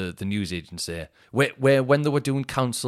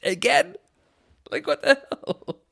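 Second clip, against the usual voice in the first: mock incredulity; 3 dB louder, slower than usual. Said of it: "incredulous aside on again".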